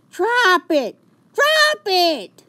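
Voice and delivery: very loud high commands; shouting. A narrator's reading. George yells at Maurice to drop Fifi. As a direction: yell the command hard.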